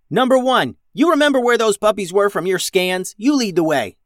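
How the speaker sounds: barked order. A narrator's reading. Captain orders robot to lead the way, commanding shout. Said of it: "ordered Captain Max".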